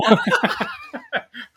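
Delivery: shrieking laugh; high burst of laughter. Real, not acted. Big laugh.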